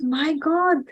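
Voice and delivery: put-on a touched villain telling the story to a child; awed Jagai. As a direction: marvel at his sincerity. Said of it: "And Jagai said".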